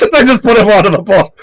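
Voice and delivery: usual speaking voice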